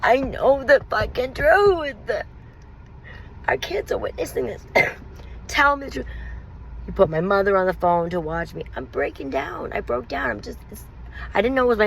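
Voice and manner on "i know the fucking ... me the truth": high, breathless whispered pleading that swells; desperate begging. Retold or imitated. Wife on her knees begging her husband for the truth, high desperate plea. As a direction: beg him for the truth, voice cracking high. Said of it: "falling on my knees, begging".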